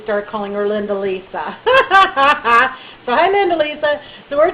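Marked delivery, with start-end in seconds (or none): 1.6-3.0 high loud burst of laughter. Real, not acted. Laughing about Linda Lisa.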